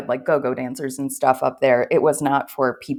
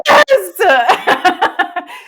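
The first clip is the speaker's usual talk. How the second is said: bursting into high loud laughter; a real big laugh breaking out; 9 dB louder than usual. Real, not acted. Laughing at her own story.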